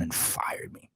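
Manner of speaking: slow, bitter whisper; hushed and slow, almost to himself. Real, not acted. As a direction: breathe it out slowly, stunned. Bitter recap of getting fired.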